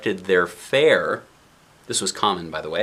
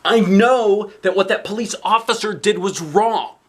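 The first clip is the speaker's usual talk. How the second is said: put-on high, louder child's declaration; the kid stating it firmly; earnest little girl; 10 semitones higher, 5 dB louder than usual. A narrator's reading. Girl tells her driving mom the officer was wrong, high earnest voice. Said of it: "she says as her mother's driving".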